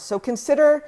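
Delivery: ordinary talk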